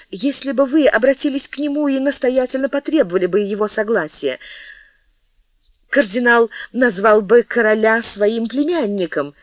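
high, pressing plea; passionate persuasion. Acted. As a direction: talk him into it, eager and high. Мария убеждает короля. (горячо убеждает, высоким голосом)

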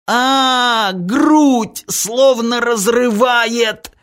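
very high, drawn-out scream of pain; agonised scream. A narrator's reading. Anton rolling on the floor, agonised scream. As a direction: wail it out slowly in agony. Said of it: "кричал Антон".

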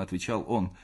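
ordinary delivery

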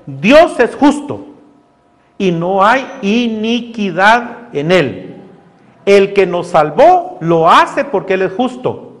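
slow, weighty declaration; emphatic principle. Real, not acted. Preacher stating the principle to the congregation, slow and weighty. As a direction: lean on every word.

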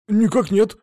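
high, stiff "no, sir"; barked soldier reply. Acted. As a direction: snap back the regulation answer. Soldier answers by regulation. (чёткий солдатский ответ)

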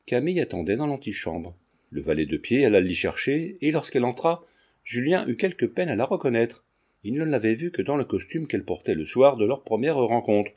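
plain reading voice

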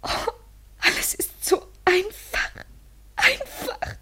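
crying. Acted. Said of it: "Wein doch".

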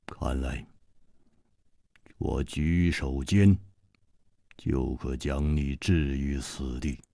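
低沉冰冷的反派嗓音，慢慢吐字; 压低声音，冷冰冰地威胁; 冷冷的黑袍客. A narrator's reading. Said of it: "黑袍客冷冷地说". (黑袍客对楚留香冷冷威胁，低沉阴冷)

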